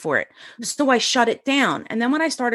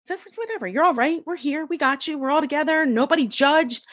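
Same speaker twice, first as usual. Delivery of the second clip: high, gentle mock reassurance; soothing circle women; 7 semitones higher than usual. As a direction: coo like the kind women comforting her. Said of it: "And they're like".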